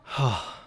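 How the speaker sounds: heavy acted sigh; weary sigh. Roommate worn out after the day, heavy sigh. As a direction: let out a tired sigh. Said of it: "just, like, sigh".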